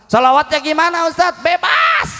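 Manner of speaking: high-pitched, quick call; mock question-and-answer. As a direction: shoot up high like an eager questioner. Acts out congregant's question and answer.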